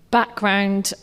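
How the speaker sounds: ordinary delivery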